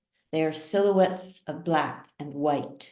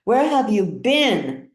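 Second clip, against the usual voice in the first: demanding; 5 semitones higher, 6 dB louder than usual. A narrator's reading. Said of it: "Ban demanded".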